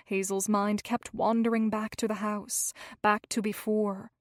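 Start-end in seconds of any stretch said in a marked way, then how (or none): none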